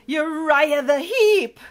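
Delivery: loud, high, drawn-out announcement; dramatic reveal. A narrator's reading. Narrator revealing the villain, dramatic.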